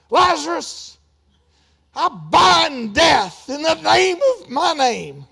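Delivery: loud, slow, hammed-up bellowing prayer; mock shouting preacher. Shown as a demonstration. Preacher mocking a shouted prayer, loud and slow. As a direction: overdo it like a straining preacher. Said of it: "He didn't say".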